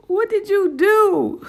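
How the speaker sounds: high, loud, slow alarmed cry. Retold or imitated. Husband hearing the news, high loud alarmed cry. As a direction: shriek it out in disbelief.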